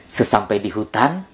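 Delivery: usual speaking voice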